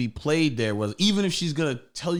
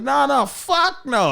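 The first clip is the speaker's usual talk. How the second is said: firm refusal; 10 semitones higher, 4 dB louder, slower than usual. Retold or imitated. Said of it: "I was like".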